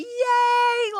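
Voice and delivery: high, loud little yay, imitating the cleaner; happy cheer. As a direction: cheer like a delighted friend. Cleaner super happy to see her.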